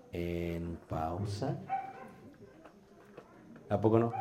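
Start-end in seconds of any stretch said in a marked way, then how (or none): 0.1-1.6 "en pausa" said very slowly, much lower and much softer; long pause. Shown as a demonstration. Teacher demonstrating the pause to hold listeners, slow and hushed. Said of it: "Ya los perdiste en el primer grito".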